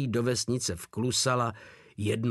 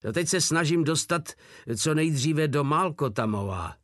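ordinary delivery

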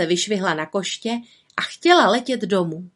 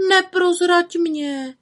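frightened plea; 10 semitones higher, slower than usual. A narrator's reading. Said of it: "prosila ustrašeně".

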